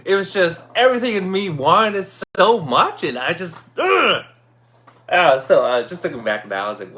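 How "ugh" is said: high effortful grunt; straining grunt. Retold or imitated. Storyteller showing how much he wanted it, straining grunt. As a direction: grunt with all your wanting in it.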